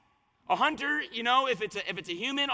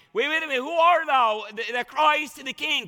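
shrieking; 5 semitones higher, 6 dB louder than usual. Acted. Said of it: "he's shrieking".

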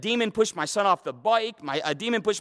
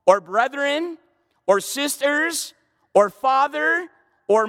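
slow, higher and louder on each "or"; emphasizing each item; 4 semitones higher, 3 dB louder, slower than usual. Shown as a demonstration. Pastor voicing Jesus to congregation, slow and emphatic on each "or". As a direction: hammer every "or" in the list. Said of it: "I'm emphasizing it 'cause he would have emphasized it".

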